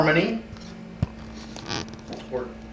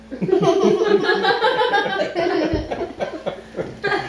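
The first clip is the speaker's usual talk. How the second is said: long high giggle; falling giggle fit; real laughter. Real, not acted. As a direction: dissolve into laughter. Breaks up mid reading.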